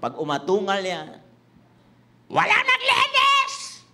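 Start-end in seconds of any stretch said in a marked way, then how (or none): 2.3-3.8 mock mother's yell, much higher and louder; high, slow, hammed-up yell of an angry mom; roaring mother. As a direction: bellow it like a mother whose house nobody cleaned.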